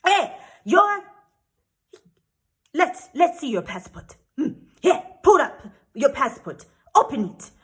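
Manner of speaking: mock outraged insistence; flustered, angry official. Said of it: "flustered and angry".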